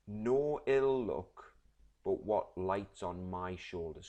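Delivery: drawn out on "No ill luck"; slow emphasis. Shown as a demonstration. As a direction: lean on "No ill luck" slowly, then ease off. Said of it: "It says".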